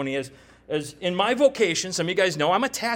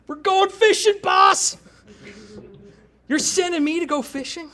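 excited Peter; 10 semitones higher, 4 dB louder than usual. Retold or imitated. Peter voiced, excited at fishing.

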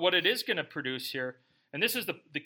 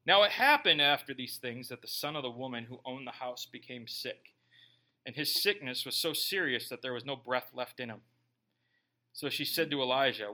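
measured, lower Bible-reading voice; scripture reading; 5 semitones lower than usual. A narrator's reading. Preacher reading scripture aloud to church, measured. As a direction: read the passage steadily to the congregation.

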